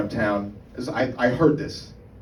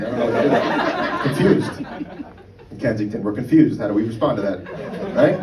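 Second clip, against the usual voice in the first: ordinary delivery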